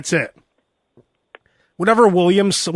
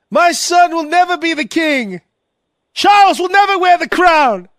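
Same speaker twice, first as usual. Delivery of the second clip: pompous royal; 8 semitones higher than usual. Pretend. Charles ranting at the queen.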